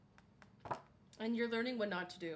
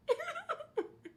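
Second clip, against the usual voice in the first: very high shrieking laugh. Real, not acted. Streamer agreeing with chat, shrieking laugh. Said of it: "That is so [name]".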